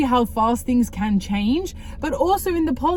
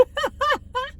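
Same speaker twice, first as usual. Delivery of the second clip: embarrassed laugh; 5 dB quieter than usual. Real, not acted. Embarrassed laugh after pleading.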